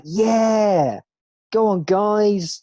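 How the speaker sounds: hammed-up high, loud cheer; mock a gushing cheerleader, stretching the words out; fake positive. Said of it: "everyone's all fake positive all the time".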